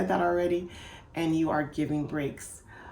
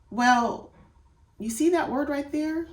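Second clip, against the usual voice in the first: higher, louder coaxing question; guiding teacher; 9 semitones higher, 4 dB louder than usual. Shown as a demonstration. Parent guiding a child to the word, higher coaxing question. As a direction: lead the child gently to the word. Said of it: "guide them".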